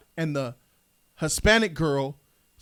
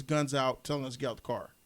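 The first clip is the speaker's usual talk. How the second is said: ordinary talk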